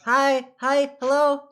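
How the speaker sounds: eager, insistent greeting to get noticed; calling for attention. Acted. Character waves from the tabletop at Blackstaff, calling out. On table waving arms, hailing her.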